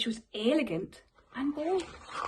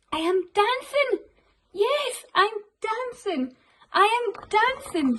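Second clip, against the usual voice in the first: high, loud delighted shouts; joyful cry; 8 semitones higher, 4 dB louder than usual. Acted. Gerald discovering he can dance, high joyful cry. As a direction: burst out with pure joy. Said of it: "Gerald cried".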